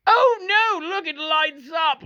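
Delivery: high, loud hammed-up thrill; mock delight. Pretending to be thrilled by a remote's buttons. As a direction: squeal over the remote like a child.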